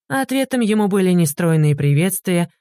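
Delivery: plain reading voice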